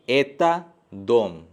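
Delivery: slow, careful Russian sentence; slow, careful demo for Hindi learners; slow teaching demo. Shown as a demonstration. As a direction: spell out एता दोम slowly for learners. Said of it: "is a कुछ भी नहीं लग रहा है".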